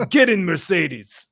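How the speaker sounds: put-on very slow, heavy Russian accent; slow mock Russian mobster. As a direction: drag it out like the mobster. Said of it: "this Russian mobster".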